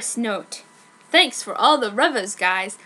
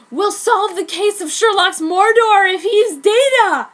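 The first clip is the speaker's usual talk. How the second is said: higher, louder anguished plea; hammed-up heartbroken friend; dramatic John; 7 semitones higher, 7 dB louder than usual. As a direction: overact the grieving friend. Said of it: "said John".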